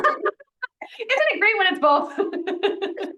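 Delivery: slow, high, laughing delivery; words stretched out through shared laughter; laughing agreement. Real, not acted. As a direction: laugh along while saying it. Both laughing at the money joke.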